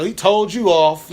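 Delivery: loud, high, slow taunting; teasing taunt. Retold or imitated. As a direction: rub it in, singsong jeer. Girl mocking him on Twitter.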